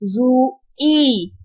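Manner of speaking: "जुई" split slowly into zu and ee; broken into parts. Shown as a demonstration. Teacher breaks it down for pupils, "जुई" in two pieces. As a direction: say "जुई" as two separate drawn-out sounds, zu, ee. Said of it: "तोड़ के बताती हूँ".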